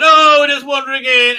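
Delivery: loud, high hammed-up oh; mocking, high-pitched loud quote; mock breeziness. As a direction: send up a character blithely barging in. Mockingly acting out show dialogue.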